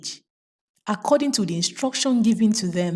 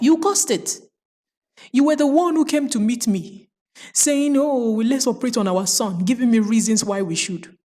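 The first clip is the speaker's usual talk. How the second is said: higher, fast and pushing blame; accusing quarrel; 7 semitones higher, faster than usual. Acted. Grieving parents blaming each other for the operation, heated accusing.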